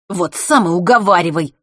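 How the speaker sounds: loud, irritated retort; snapping back. A narrator's reading. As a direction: snap it back at him. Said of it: "огрызнулся Норт".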